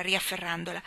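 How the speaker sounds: plain reading voice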